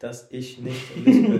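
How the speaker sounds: "dass ich nicht lispel" said loud and slow, insisting; indignant loud protest. Real, not acted. Protesting to her friend who teased her lisp, loud and drawn out. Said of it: "Ey, du bist so uncool".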